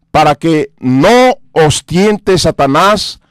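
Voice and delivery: slow, hushed reading of the verse; solemn scripture reading. Acted. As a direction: let the verse sink in, almost whispered at the end. (lectura solemne y pausada)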